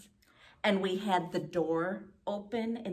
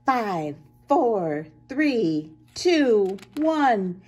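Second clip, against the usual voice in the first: building countdown; 2 semitones higher, 11 dB louder, much slower than usual. Acted. Counting down the rocket launch, louder each number. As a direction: build up to blast-off.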